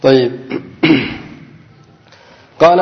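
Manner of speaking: usual speaking voice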